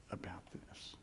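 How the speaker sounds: very low, hushed emphasis; grave whisper. Real, not acted. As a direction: drop to a whisper for solemn weight. Preacher underlines Jesus's seriousness.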